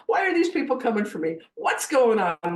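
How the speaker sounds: loud, high, panicky questions; freaked out. Retold or imitated. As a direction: re-enact being overwhelmed, crying out. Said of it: "I was completely freaked out".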